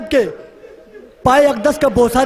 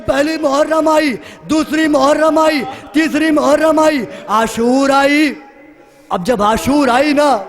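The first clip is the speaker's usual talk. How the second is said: usual speaking voice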